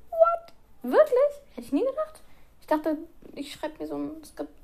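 very high fake astonishment; mock surprise. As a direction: play it as sarcastic shock. Mock surprise at own podcast.

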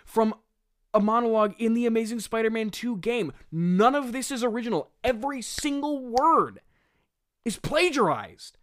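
high-pitched, emphatic indignation; incredulous rant. Real, not acted. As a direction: hammer every word in comic outrage. Indignant comic rant about plagiarism.

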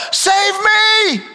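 high cry for help; mild plea. Acted. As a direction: beg to be saved. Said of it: "he said, 'Lord".

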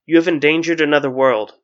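loud, forceful accusation. A narrator's reading. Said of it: "He stepped forward and raised his voice".

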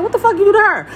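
high, indignant demand; retold angry confrontation. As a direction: replay accusing someone who upset your baby. Replays confronting the baby's father.

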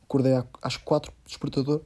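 ordinary talk, nothing marked

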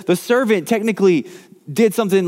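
ordinary talk, nothing marked

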